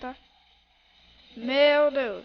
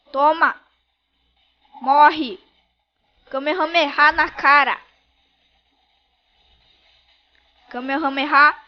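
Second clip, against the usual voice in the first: loud heated gamer shouts; shouting in battle; 8 dB louder than usual. Real, not acted. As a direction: yell through the fight.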